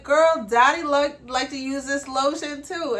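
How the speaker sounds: a light take on the sister's surprised remark; imitating sister. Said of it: "she is like".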